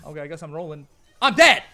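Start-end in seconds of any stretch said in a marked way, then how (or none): 1.2-1.6 sudden loud outburst; shouting. Real, not acted. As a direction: yell it in shock. Just died in game.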